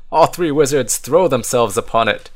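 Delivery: usual speaking voice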